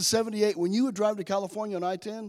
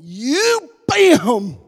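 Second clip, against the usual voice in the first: loud, high acted blow-up; explosive outburst; 5 semitones higher, 10 dB louder than usual. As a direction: burst out at your friend, hammed-up. Acts out his outburst at friend.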